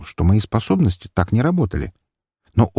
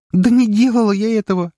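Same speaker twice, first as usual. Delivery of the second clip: high, loud, tearful cry; almost scream it in despair; near-shouting denial; 3 dB louder than usual. A narrator's reading. Girl near tears shouts her denial at the lawyer. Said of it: "едва не закричала она".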